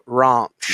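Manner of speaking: ranch said as "rahnch" with a long open ah; southern English ranch. Shown as a demonstration. Podcast host demoing a Southern English ranch. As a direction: stretch the a in ranch into a broad ah. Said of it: "you would have more of an ah sound".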